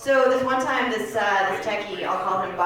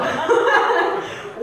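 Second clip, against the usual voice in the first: high, loud giggling laugh; 9 semitones higher, 5 dB louder than usual. Real, not acted. Laughing between bits.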